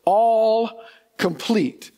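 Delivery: all complete stretched slowly; all complete said slowly with weight on all; stress on all complete. Shown as a demonstration. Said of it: "I cannot emphasize that word there enough".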